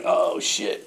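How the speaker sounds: breathless whisper of dread; whispered oh shit. Retold or imitated. Caught by the dog search, a whispered curse. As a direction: hush it out like you just got caught.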